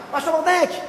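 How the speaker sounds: high, delighted call to the pastor; beaming greeting. Retold or imitated. Nursery owner spots the pastor arriving, beaming retold greeting. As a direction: greet him with a big smile. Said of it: "ela já veio assim, com um sorriso".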